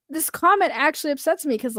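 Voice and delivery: usual speaking voice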